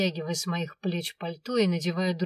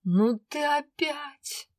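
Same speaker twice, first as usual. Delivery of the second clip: exasperated dragon; 8 semitones higher, much slower than usual. A narrator's reading.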